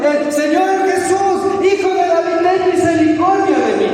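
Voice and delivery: preacher acting out a desperate cry; imitated cry for mercy. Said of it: "empezó a gritar".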